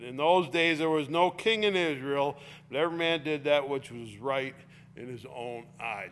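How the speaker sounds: loud, ringing Bible reading; scripture reading. Acted. Preacher reading Judges aloud to the congregation, loud and ringing. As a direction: proclaim the text to the congregation.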